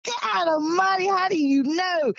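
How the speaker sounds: high, drawn-out disbelief; retold astonishment. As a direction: exclaim it high and slow, reliving the shock. Retelling her own astonished reply.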